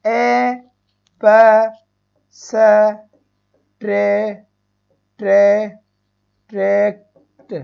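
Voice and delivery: एब्स्ट्रैक्ट broken into ए, प, स, ट्रे, slowly; slow sound-by-sound teacher breakdown. Shown as a demonstration. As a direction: spell out each sound with pauses. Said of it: "यहाँ पर भी क्या होगा आपका".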